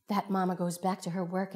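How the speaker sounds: usual speaking voice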